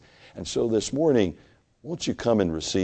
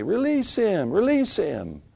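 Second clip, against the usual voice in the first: crowd's cry; 13 semitones higher than usual. Acted. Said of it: "they would say".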